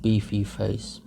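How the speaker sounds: ordinary delivery, nothing marked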